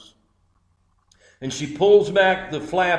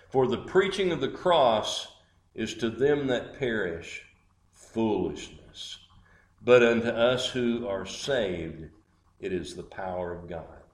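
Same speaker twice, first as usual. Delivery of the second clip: low, soft, measured preaching; solemn scripture recitation; 7 semitones lower, 9 dB quieter than usual. Real, not acted. Preacher closing the story with scripture, low solemn recitation. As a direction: recite it low and hushed, with pauses.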